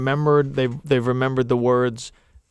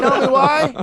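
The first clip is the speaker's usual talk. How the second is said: high, louder exasperated mock yell; 12 semitones higher, 5 dB louder, slower than usual. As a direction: shout it back in comic disbelief. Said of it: "I'm yelling".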